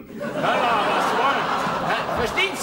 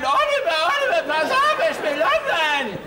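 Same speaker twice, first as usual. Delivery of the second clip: mock yell, much higher and louder, fast; shrill tennis mother; 7 semitones higher, 4 dB louder than usual. Pushy mother yelling to her son Oliver on court, mock screech. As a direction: ham up a pushy tennis mum calling out. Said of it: "dann schreit diese Person da immer rein".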